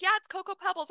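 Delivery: put-on boy answering his mother; little boy voice. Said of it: "He's like".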